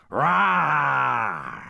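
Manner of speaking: long, loud, high roar; drawn-out beastly yell; dragon roar. A narrator's reading. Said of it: "Hearing Dragon's roar again".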